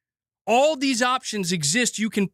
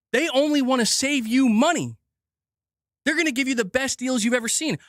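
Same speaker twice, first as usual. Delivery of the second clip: mock sincerity; 4 semitones higher than usual. Sarcastic corporate generosity.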